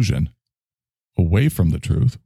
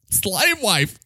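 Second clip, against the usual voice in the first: a higher, theatrical declaration of slave wife; high character voice; 12 semitones higher, 3 dB quieter than usual. Acted.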